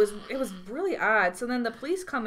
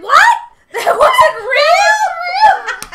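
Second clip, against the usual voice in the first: very high, loud shriek of disbelief; mock-shocked yell, drawn out and laughing; retold incredulous shout; 16 dB louder, much slower than usual. Retold shocked reaction to prank.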